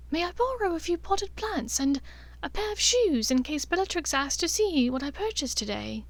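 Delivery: an elegant mother soothing her son; fond mother. A narrator's reading. Narcissa voiced by narrator.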